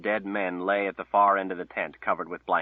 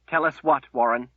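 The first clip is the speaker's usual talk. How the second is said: higher, louder tearful asking; through tears; 5 semitones higher, 7 dB louder than usual. Acted. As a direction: beg for the words while crying. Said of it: "Verna asked through her tears".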